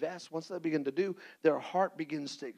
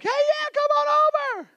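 falsetto squeal of a too-eager host, much higher and louder; mock cheery host; 12 dB louder than usual.

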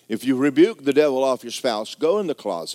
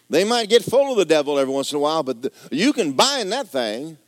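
usual speaking voice

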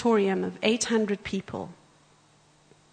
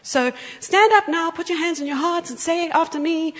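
higher, louder rallying call; calling the room up; 7 semitones higher, 5 dB louder than usual. Real, not acted. Preacher calling the congregation to stand, loud. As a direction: rouse the whole room to stand. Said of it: "leading the congregation".

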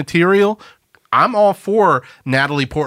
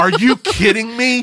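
surprised; 10 semitones higher than usual. Real, not acted. Shocked at the movie reveal.

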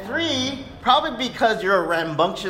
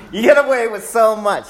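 firm repeat; 6 semitones higher, 7 dB louder than usual. Retold or imitated. Father quoting himself to son.

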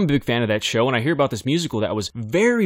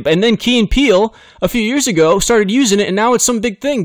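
much higher and louder, fired up; bursting with excitement over the catchphrase; excited; 9 semitones higher, 6 dB louder than usual. Real, not acted.

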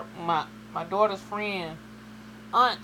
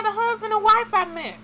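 much higher, innocent mother voice; mimicking mom; 4 dB louder than usual. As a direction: play the mom, certain.

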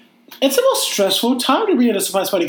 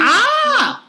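startled yelp. Real, not acted. Bangs phone on the table.

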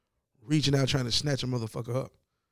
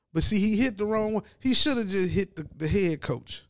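usual speaking voice

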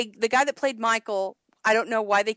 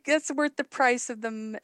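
ordinary talk, nothing marked

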